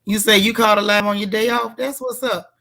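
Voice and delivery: normal talking voice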